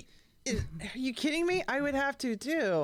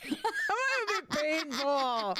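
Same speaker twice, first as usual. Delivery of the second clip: laughing; 6 semitones higher, slower than usual. Real, not acted. Laughing at own joke.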